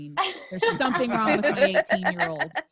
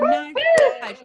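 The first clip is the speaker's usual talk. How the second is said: announcer shout; 11 dB louder than usual. Real, not acted. Host shouting name.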